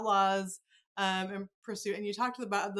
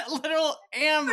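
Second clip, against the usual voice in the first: laughing; 6 semitones higher, 7 dB louder, faster than usual. Real, not acted. Host laughing at the pun.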